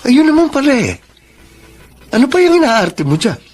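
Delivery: high, sharp, annoyed snap; irritated retort. Acted. Husband snaps at his wife for making a scene, annoyed. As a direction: brush off her complaint with irritation.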